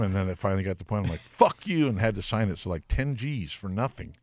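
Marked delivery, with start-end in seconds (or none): 1.2-1.9 mock angry snap. Finally snaps at promoters.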